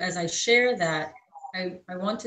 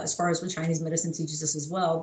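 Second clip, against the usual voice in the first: ordinary talk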